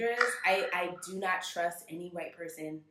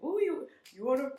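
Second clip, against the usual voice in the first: higher, excited mock eagerness; eager ooh; 7 semitones higher, 3 dB louder than usual. Recalling eagerness to join the topic, higher and excited. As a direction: perk up eagerly. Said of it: "I was like".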